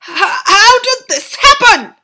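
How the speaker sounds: high, loud, slow, near tears; father broken by the news; despairing cry. A narrator's reading. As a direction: plead in disbelief.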